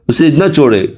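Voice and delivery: ordinary talk, nothing marked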